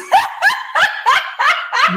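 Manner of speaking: high-pitched cackle of laughter. Real, not acted. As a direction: cackle at the clip. Cracks up at clip.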